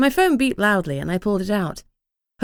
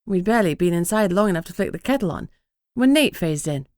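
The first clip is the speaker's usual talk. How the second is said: ordinary delivery, nothing marked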